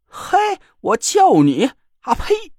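slow 我叫你 then a high spat-out 啊呸; indignant scoff. A narrator's reading. Joker refusing to call her sister: indignant spit. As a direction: spit the idea away.

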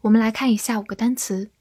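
usual speaking voice

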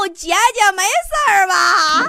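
laughing Tianjin flattery; Tianjin sweet talk. Retold or imitated. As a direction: sweet-talk her in Tianjin dialect, stretched slow. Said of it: "天津话的口头禅".

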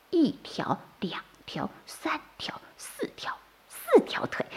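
hushed, very soft whisper; whispered counting. A narrator's reading. Baby turtles count the softshell turtle's legs, whispering. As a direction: count carefully under the breath.

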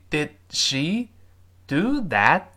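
slow "did she do that", final d and t held; careful teacher's demo of silent stops; unreleased stops. Shown as a demonstration. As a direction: hold the d of "did" and the t of "that" without releasing. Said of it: "失去爆破这个动作".

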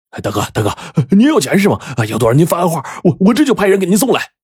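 high, rushed, stammering begging; panicked pleading. A narrator's reading. Kneeling father offers ransom to the boss, panicked pleading. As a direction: beg the gang boss in a frantic high voice.

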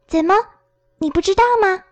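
音调高扬，十分意外; 惊讶地拔高声音反问. A narrator's reading. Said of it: "朵洛西吃惊地问". (小女孩吃惊地高声追问)

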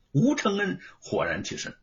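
usual speaking voice

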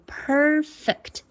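slow PER-fect, long first syllable; stress on first syllable. Shown as a demonstration. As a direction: lean on PER in "perfect", separate the FECT. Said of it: "一般会在第一个音节重读".

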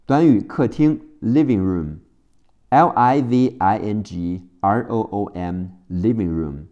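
usual speaking voice

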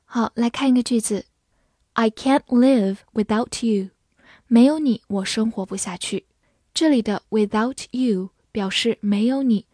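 normal talking voice

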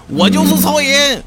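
high, loud, slow proclaiming yell; loud shouting. Retold or imitated. Director quoted shouting he is Superman, loud high yell. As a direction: bellow it like a madman. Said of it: "完了还大声吵吵".

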